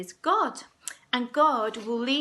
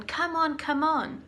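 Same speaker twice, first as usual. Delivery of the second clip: urging on; 5 semitones higher than usual. Acted. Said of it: "they were going".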